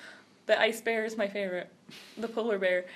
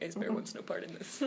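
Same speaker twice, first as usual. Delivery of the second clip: low, much softer monotone; deadpan refusal; 5 semitones lower, 13 dB quieter than usual. Retold or imitated. Girl quoting the polar bear's catchphrase, quiet deadpan. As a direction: shrug it off under your breath.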